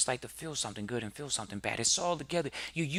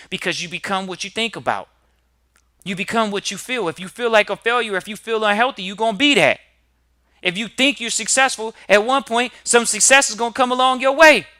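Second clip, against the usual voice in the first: loud, higher, rising preacher's cry; preaching at full force; 7 semitones higher, 14 dB louder than usual. Acted. Motivational host firing up his audience, full preaching shout. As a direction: drive it home like a sermon peak.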